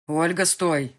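loud call to stop someone running away; man shouting after a fleeing girl; shout after her. Acted. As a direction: yell it at her back. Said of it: "донеслось мне в спину".

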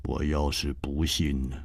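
低沉冰冷的反派嗓音，慢慢吐字; 压低声音，冷冰冰地威胁; 冷冷的黑袍客. A narrator's reading. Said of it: "黑袍客冷笑着说". (黑袍客对楚留香冷冷威胁，低沉阴冷)

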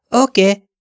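high-pitched obedient reply. A narrator's reading. Huge henchman agrees to go into the bathroom in a high voice. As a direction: answer small and high, as the big brute. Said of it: "mondta nagyon magas hangon".

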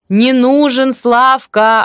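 slow, loud, high shout; drawn-out emphatic yell. A narrator's reading. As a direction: spell it out loudly for someone hard of hearing. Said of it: "опять крикнула Глаша".

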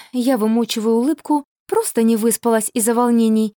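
ordinary delivery

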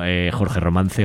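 normal talking voice

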